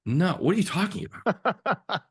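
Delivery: high, loud laughing disbelief; incredulous. Retold or imitated. Filmmaker rejecting a studio title, laughing disbelief. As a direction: squeal it in amused shock. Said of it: "And you're like".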